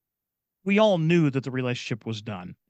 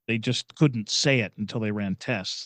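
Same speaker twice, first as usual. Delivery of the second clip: normal talking voice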